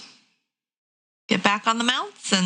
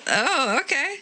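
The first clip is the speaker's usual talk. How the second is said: high, unsure hello; nervous high greeting; timid greeting; 7 semitones higher than usual. Acted. Dinsby greets strangers, timid and high. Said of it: "Dinsby will kind of be like".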